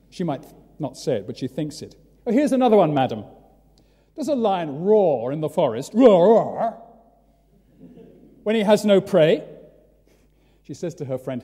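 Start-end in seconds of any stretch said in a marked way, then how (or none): 2.3-5.9 loud, high open-air preaching; preacher's shout. Retold or imitated. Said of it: "says the preacher". 5.9-6.8 loud growling roar, roar; hammed-up lion roar; lion roar imitation. 8.4-9.4 loud, high open-air preaching; preacher's shout. Retold or imitated. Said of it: "says the preacher".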